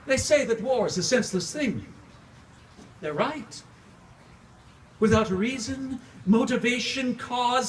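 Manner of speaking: impassioned theatrical recitation swinging from hush to outcry; dramatic monologue. Acted. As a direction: pour it out like a tormented vampire mourning his wife. Performs own Dracula monologue.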